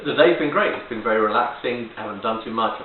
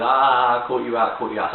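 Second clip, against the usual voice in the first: gotcha; 2 semitones higher, 6 dB louder than usual. Retold or imitated. Gleeful gotcha to friend.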